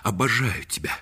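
loud, high, urgent pleading; ardent proposal. Acted. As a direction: press for a yes, building to a cry. (страстно, настойчиво умоляя)

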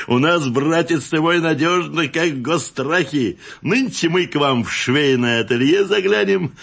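hearty back-slapping confidence; chummy customer. A narrator's reading. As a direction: reassure an old pal heartily. Grinning customer reassures his accomplice.

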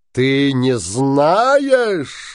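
slow, drawn-out roar of disbelief; furious shout. Acted. Bluebeard roaring at his trembling wife, slow furious shout. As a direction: bellow the question, stretching every word. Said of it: "закричал Синяя Борода".

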